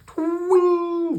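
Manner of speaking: loud, very high "twing"; ping it out like a snapping rope; high, ringing sound effect; imitated twang. A narrator's reading. Imitates the knot slipping loose.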